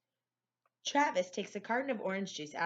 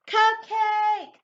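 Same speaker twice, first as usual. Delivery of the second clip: very loud, high, drawn-out cry; huge high-pitched whoop of delight; excited shout; 13 dB louder than usual. A narrator's reading. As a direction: holler it greedily at the sight of a treat. Boy blurts at sight of cupcake.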